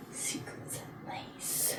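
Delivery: slow breathy whisper of the name. Acted. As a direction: whisper it seductively.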